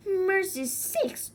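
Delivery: high, slow surprised aunt; surprised exclamation. Acted. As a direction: gasp it out like a startled aunt.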